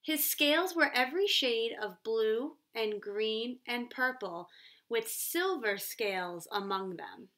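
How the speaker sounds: ordinary talk, nothing marked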